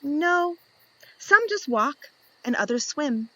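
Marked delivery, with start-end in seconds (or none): none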